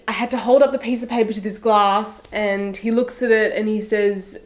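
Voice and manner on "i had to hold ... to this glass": ordinary delivery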